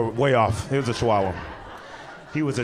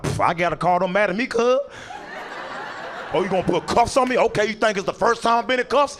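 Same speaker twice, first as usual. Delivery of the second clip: raised-pitch mock bravado; acting tough; 6 semitones higher than usual. Teen showing off for brother while cuffed, acting tough. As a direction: put on a cocky front, overdoing it. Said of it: "trying to be tough in front".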